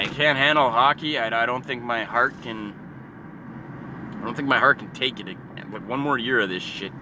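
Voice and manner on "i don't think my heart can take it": normal talking voice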